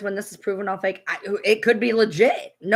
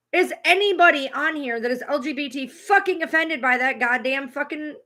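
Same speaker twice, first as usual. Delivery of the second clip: ordinary delivery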